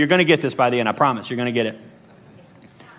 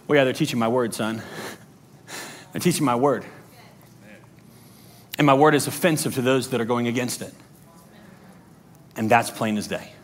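low, soft, unhurried reply; calm God; 12 semitones lower, 9 dB quieter than usual. Acted. God replying to an offended believer, calm and low. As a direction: answer quietly with settled authority. Voices God calmly answering a prayer.

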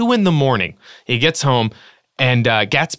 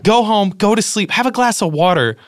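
exasperated order; 8 semitones higher than usual. Retold or imitated. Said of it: "I'm like".

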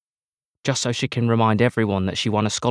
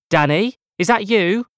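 calling out; 11 semitones higher, 5 dB louder than usual. A narrator's reading. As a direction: call out high and loud from another room. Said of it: "Mum calls to me".

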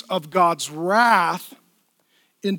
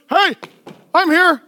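louder and higher, a mock look-at-me call; showy Peter; 9 semitones higher, 6 dB louder than usual. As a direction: imitate Peter pushing himself forward. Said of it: "Peter's always the guy like".

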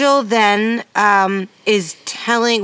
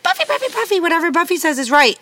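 much higher, fawning sing-song that rushes the end; higher mock hero-worship of Buffy; mock fawning chorus; 8 semitones higher, much faster than usual. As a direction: gush over Buffy like an adoring fan. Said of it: "everyone on the show is like".

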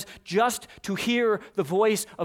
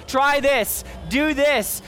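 a hint of a tempting world, louder; 6 semitones higher, 7 dB louder, slower than usual. World tempting believers, lightly voiced and pushy. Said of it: "the world trying to tell us".

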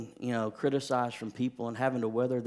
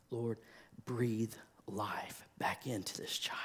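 much softer, whispered plea; hushed prayer; 8 dB quieter than usual. Retold or imitated. Father praying over his wife and child, in a hushed whisper. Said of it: "started praying".

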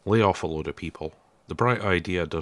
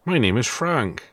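higher, louder mock Mumbai-accented greeting; lightly put-on Mumbai accent, pitched up; imitated Mumbai accent; 5 semitones higher, 4 dB louder, slower than usual. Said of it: "speaking in a thick Mumbai accent".

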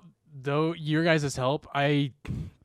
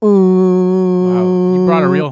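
surprised; 7 semitones higher, 15 dB louder, much slower than usual. Real, not acted. Reacting to a loud horn blast.

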